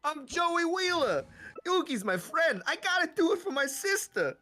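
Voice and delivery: very high, loud cartoon accent; Joey Wheeler. Introducing himself and his cause, loud cartoon accent. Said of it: "go in with the Joey accent".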